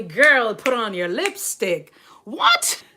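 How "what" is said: high, startled squeak; sudden mock astonishment; surprised what. Real, not acted. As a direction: pretend shock at your own line. Mock shock at own remark.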